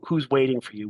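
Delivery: ordinary talk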